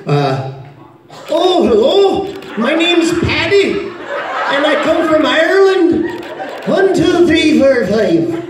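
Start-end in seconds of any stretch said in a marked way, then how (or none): 1.3-8.4 high, drawn-out, hammed-up Irish lilt; bad Irish accent. Shown as a demonstration. Showing off for the audience, extra bad Irish accent. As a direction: do a deliberately awful Irish accent, singsong and high. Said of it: "This is my Irish".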